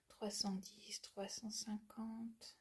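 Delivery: slow whisper while reading the label; breathy slow read-out to herself. Real, not acted. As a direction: whisper the numbers slowly as she reads.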